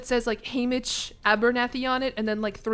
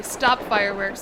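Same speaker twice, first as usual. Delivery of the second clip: ordinary talk, nothing marked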